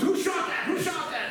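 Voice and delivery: high, loud "Who shot that?"; startled yell. Retold or imitated. Friends turning round in shock, high startled yell. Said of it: "everybody turns around and goes".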